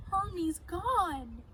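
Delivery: slow, high, mock-sobbing wail; hammed-up crying kid. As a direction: blubber it out through tears. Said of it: "Between sobs and sighs, he sputtered".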